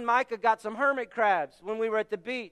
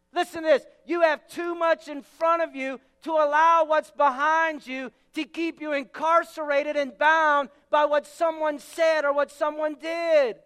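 ordinary talk, nothing marked

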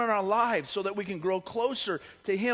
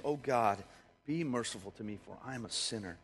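much lower and softer, sinking plea; sorrowful plea; 10 semitones lower, 9 dB quieter than usual. A narrator's reading. Tax collector begs God for mercy, lower and softer. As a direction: pray it low and humbled. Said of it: "he beat his chest in sorrow".

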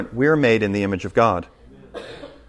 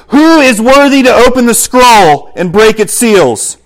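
higher, louder and slow, proclaiming; angel's loud proclamation; 11 semitones higher, 10 dB louder, slower than usual. Acted. Preacher voices the angel's question to the church, proclaiming loudly. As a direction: call it out like a mighty angel. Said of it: "proclaiming with a loud voice".

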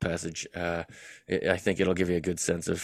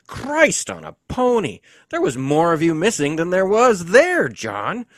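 high, loud, slow exclamation; loud high astonished outburst; exclaiming; 11 semitones higher, 9 dB louder than usual. Acted. Harry exclaims at his injuries.

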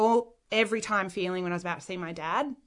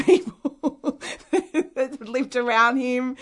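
laughing through the words; amused laughing; 5 semitones higher, 4 dB louder, slower than usual. Real, not acted. Daughter recalls dad knowing all the famous people, laughing. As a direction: break into a real laugh mid-sentence.

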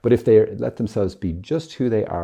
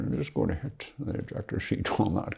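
hushed, breathy spy murmur, much softer; James Bond impression; 6 dB quieter than usual. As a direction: whisper it like a spy in the shadows. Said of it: "This is my James Bond impersonation".

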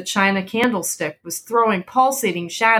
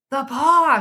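sharp high cry of sudden alarm; a sudden wild cry in the night; 7 semitones higher, 3 dB louder than usual. A narrator's reading. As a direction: blurt it out wildly, voice jumping up. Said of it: "she cried wildly".

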